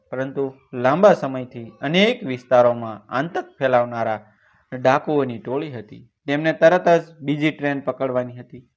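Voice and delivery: ordinary delivery, nothing marked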